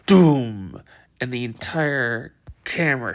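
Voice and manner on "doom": mock game sound effect boom; booming doom sound. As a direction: boom it out like a distant thud. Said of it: "It goes".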